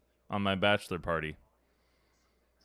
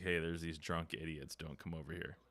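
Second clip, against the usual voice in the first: hushed; 4 semitones lower, 12 dB quieter than usual. Retold or imitated. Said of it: "Be like".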